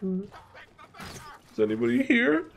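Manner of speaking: tiny high squeak, drawn out slowly; squeaked-out retelling. Retold or imitated. Storyteller acting his scared self in the dark, squeaking. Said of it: "So I just kinda, like, squeak out".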